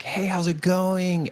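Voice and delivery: high, slow breathy-free falsetto greeting, mocking a bored celebrity; squeaky high mock greeting, drawn out slowly; imitating Sam. Fan mimics Sam greeting her at the autograph table, high and slow. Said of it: "he's like".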